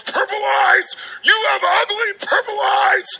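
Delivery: a kid yelling at a stranger, high mocking child voice; taunting little kid. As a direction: squeal it like a mean little kid. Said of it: "this little kid comes up to you and goes".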